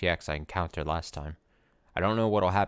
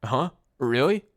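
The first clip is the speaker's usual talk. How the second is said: shocked; 6 semitones higher, 6 dB louder, slower than usual. Acted. Shocked at enemy's presence.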